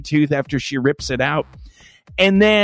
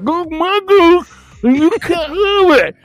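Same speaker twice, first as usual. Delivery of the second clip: high, slow mumble through a numb mouth; numbed post-extraction slur; slurred numb mouth; 5 dB louder, slower than usual. Retold or imitated. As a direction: say it like your mouth is full of novocaine. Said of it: "it sounded more like".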